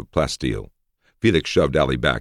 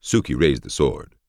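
usual speaking voice

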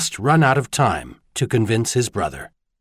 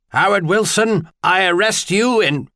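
bellowing; 8 semitones higher than usual. A narrator's reading. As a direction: bark the arrest across the room. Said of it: "bellowed from behind".

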